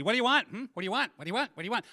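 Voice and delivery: high, rapid-fire mock nagging; pestering. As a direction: imitate people badgering, over and over. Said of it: "people kept asking me".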